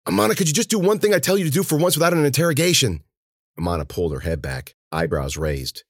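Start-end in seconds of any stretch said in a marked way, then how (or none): 0.1-3.0 fast, high exasperated burst; all at once. A narrator's reading. Cece fed up with the girl's questions, blurting it all at once. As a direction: rattle it out in one breath. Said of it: "came out all at once".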